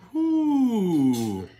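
loud, high happy whoop; joyful whoop over the food; excited whoo. Real, not acted. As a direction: let out a delighted whoo.

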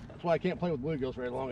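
usual speaking voice

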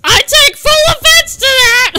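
high, loud offended outburst; indignant yell. Real, not acted. Gamer taking full offense, high indignant yell. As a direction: shout it as a grievance.

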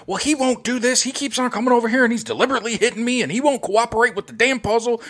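put-on high, fast whining kid voice; high-pitched kid complaint, rushed; whiny kid. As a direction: tattle like an indignant child. Voicing daughter complaining about brother.

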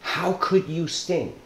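a mildly hurt frog asking the scorpion why; anguished frog. Retold or imitated. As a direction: ask it slowly, stung by betrayal. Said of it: "And he says".

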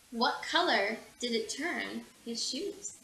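ordinary delivery

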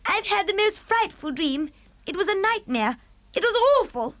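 a queen shaken by a nightmare; the Queen upset. Acted. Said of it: "a very well-known voice".

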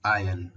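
आयरन said ajən with no r; आयरन as eye-un, r silent. Shown as a demonstration. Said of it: "आर silent है".

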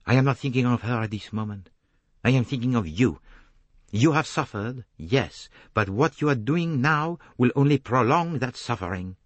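put-on firm, accented detective; earnest appeal in the detective's voice; Poirot, earnest. Detective pleads with the suffering woman, earnest accented voice.

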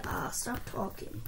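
whispered. Real, not acted. Muttering at friend.

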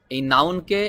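ordinary delivery

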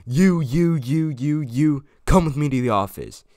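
put-on loud high barked orders, pointing at each kid; teacher yelling. Teacher ordering the caught kids to the office, loud stern yell. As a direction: call them out one by one, furious.

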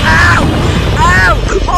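loud, high shocked cry; a sudden loud scream of surprise; startled yell. Real, not acted. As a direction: jump and yell. Sudden monster attack.

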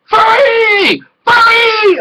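very high, loud shout of furry; excited yell. Retold or imitated. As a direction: squeal it out like a delighted fanboy. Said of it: "he goes".

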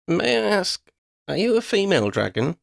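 high, curious probing question; probing girl. A narrator's reading. Girl probes dragon, high curious voice. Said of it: "Lydia probed".

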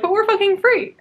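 high, loud mock cheer; chipper. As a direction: chirp it brightly like nothing hurts. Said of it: "so, like, chipper".

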